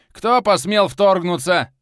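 slow, loud outraged roar; furious roar. A narrator's reading. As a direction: roar at the intruders. Clan head roars.

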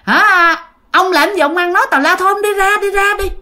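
high, indignant scolding that keeps climbing; father scolding the stranger out of his house, high and indignant; retold scolding. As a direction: chase the old man out, pitch rising. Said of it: "Xong cái ba chửi quá trời luôn".

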